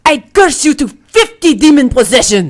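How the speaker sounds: loud cursing hag voice. Acted. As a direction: hurl the curse like a witch.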